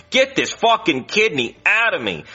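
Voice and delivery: drawn-out, high imitation of screaming; slow, emphatic retold scream; mock scream. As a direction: act out a desperate yell without full volume. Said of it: "in the cafeteria and scream".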